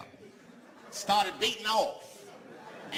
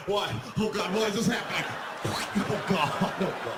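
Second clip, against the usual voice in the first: panicked victim. Acted. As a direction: plead like it cannot be happening.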